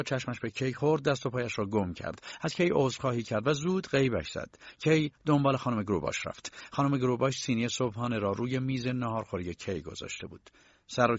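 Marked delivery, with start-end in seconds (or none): none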